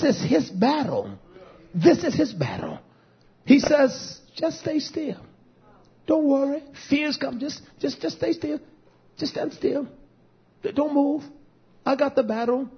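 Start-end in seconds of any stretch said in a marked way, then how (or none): 4.4-12.7 high, soft, soothing divine voice; calm a frightened people softly; gentle, light, hushed comforting; hushed reassurance. Acted. Voices God calming the people.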